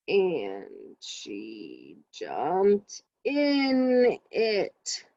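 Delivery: painfully slow, every word dragged out; too slow. Shown as a demonstration. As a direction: drag each word as a wrong example. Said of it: "Does that sound correct? Mm-mm".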